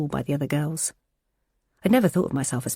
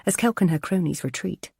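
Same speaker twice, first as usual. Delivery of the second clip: ordinary delivery, nothing marked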